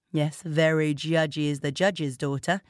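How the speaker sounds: ordinary delivery, nothing marked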